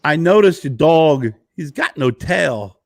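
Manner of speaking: Christopher Walken impression. Walken addressing Jay about his dog, halting impression. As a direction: pause oddly after the name, punch the words. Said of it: "he said, Christopher looks at me".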